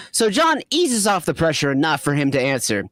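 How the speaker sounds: usual speaking voice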